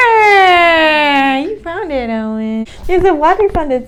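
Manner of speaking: high, loud delighted call; excited. Real, not acted. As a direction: point it out with a thrill. Child finds fountain in picture.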